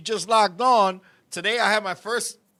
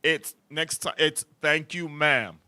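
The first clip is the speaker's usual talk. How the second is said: mock deep low voice, lower pitch; imitating the deep voice of the man in the pink coat; imitating his deep voice; 7 semitones lower than usual. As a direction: lay on a deep man's voice saying ma'am. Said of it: "he went like this".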